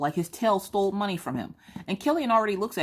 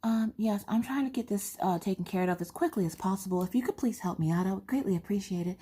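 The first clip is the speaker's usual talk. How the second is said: higher, careful, courteous caller; customer service voice; 4 semitones higher than usual. Acted. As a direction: switch back into polite phone manners. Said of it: "get back on the phone with customer service".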